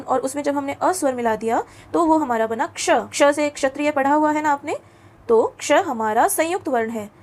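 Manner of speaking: ordinary delivery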